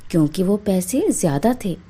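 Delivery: usual speaking voice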